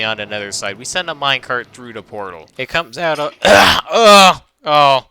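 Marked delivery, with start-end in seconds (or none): 2.6-5.1 sudden loud, high crack. Real, not acted. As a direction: voice cracking loudly on "uh". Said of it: "epic voice crack".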